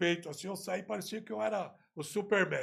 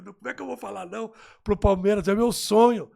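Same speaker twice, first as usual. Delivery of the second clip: rising and louder into an excited plea; excited outburst; 3 semitones higher, 10 dB louder than usual. Retold or imitated. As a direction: blurt out the dream with growing force. Turning down nothing, Palmeiras dream. (desabafo empolgado e mais alto)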